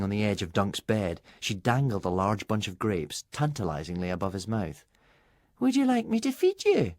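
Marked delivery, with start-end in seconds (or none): 5.6-6.9 lightly voiced purr, much higher; a teasing offer, sweetly high. A narrator's reading. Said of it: "she purred".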